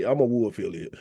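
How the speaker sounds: mocking fake affiliates. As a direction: lightly parody a fake claimer. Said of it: "a bunch of people that say".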